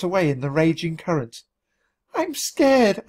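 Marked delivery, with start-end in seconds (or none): 2.1-3.0 high, frightened squeak; scared squeak. A narrator's reading. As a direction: squeak it in fear. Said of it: "I squeaked".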